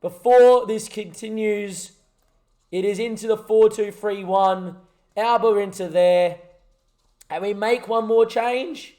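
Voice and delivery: match caller willing the final whistle; live commentary. Acted. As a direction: narrate the closing minutes like a broadcaster.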